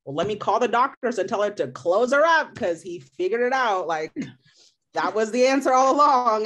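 loud, high mock sarcasm; sarcastic, rising outrage; sarcastic retort. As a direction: scoff at the doctor's advice. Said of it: "And I was like".

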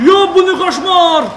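loud, high, slow wail; horrified outcry. Real, not acted. Player exclaiming at a nightmare in game, loud drawn-out outcry. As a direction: draw it out in a high, loud cry.

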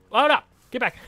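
high, loud oh no get back; sudden alarmed yell; alarmed shout. Real, not acted. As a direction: shout it to stop him. Animal wandering off.

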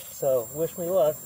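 ordinary talk

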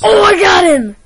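loud, high triumphant shout; excited shout. Real, not acted. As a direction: yell it out in victory. Killing an enemy in game.

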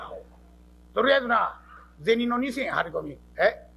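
ordinary talk, nothing marked

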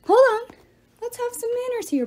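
loud, high-pitched protest; crying out. A narrator's reading. Leo stops the attackers, crying out high. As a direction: cry out high to halt them. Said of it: "but Leo cried".

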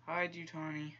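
mildly insistent order; urging. Real, not acted. Urging game character to hide.

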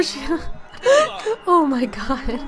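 exclaim, then collapse laughing; cracking up. Real, not acted. Cracks up at the sister.

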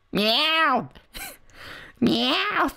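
playful imitated cat meow; drawn-out mock meow with a laugh; Meowth's meow. Voices Meowth's cry.

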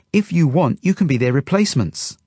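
put-on high little-girl voice; Zelda. A narrator's reading. Zelda makes the couple an offer, high child voice. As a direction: pipe up like a small child making an offer.

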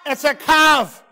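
loud, high announcement; excited reveal. Real, not acted. Telling the children the guess, an excited loud reveal. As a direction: call out the answer with glee.

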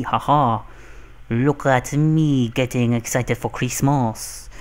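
hammed-up, badly faked French accent; exaggerated mock-French elf voice; terrible French accent. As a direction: put on a silly French accent, dragging the words. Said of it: "That's not even French. That's terrible".

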